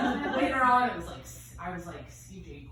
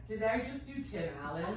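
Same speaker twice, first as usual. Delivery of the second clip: ordinary talk, nothing marked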